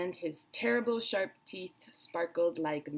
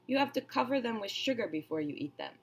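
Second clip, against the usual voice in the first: a hint of a higher, fussy crocodile voice; smaller crocodile explaining; 6 semitones higher than usual. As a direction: explain it like a picky eater. Smaller crocodile continues protesting.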